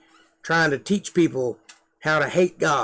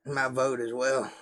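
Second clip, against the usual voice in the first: ordinary talk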